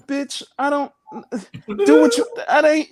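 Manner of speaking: high, loud mock brush-off; dismissive guy. Comic voicing a careless man hearing she's pregnant, high mock outrage. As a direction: play the guy waving her off. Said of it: "it's like".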